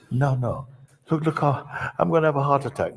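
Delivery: mock panic. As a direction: ham up panic with a laugh. Disciple panics, laughing.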